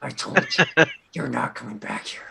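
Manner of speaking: low, soft, halting threat; through the teeth. Retold or imitated. Gotham character warning Penguin, low tight menace. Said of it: "Talk to our teeth".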